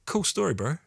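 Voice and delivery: put-on Kiwi accent, high and casual; New Zealand accent. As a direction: say it like a laid-back New Zealander. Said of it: "putting on my New Zealand accent".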